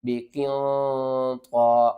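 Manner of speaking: بقیان طا very slow with a full, heavy طا. Shown as a demonstration. Teacher showing students the heavy letter, slow. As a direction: say بقیان طا with the طا thick and drawn out. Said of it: "طا کو آپ نے موٹا کر کے پڑھنا ہے".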